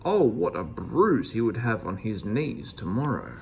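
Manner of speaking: ordinary delivery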